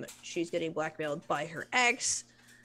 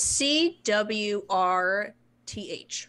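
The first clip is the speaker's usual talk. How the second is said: very slow, letter by letter; drawn-out letters, raised a touch; spelling it out; 2 semitones higher, 6 dB louder, much slower than usual. Real, not acted. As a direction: spell it out slowly, a little louder. Answering how it is spelled.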